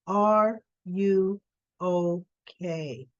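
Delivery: very slow, word by word; pointed concern. Retold or imitated. Monica checking on Olivia by phone, slow and deliberate. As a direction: space out each word of the concerned question.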